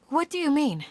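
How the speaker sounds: high, startled question; surprised question. Acted. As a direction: jump at the news. Startled by uncle's remark.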